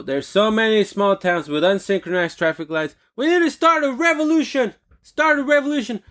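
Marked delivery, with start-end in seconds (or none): 3.2-6.0 very high, impassioned call to arms; rally the townsfolk with over-the-top outrage; mock rallying cry. Ranter urging locals to revolt over traffic lights, mock rallying cry.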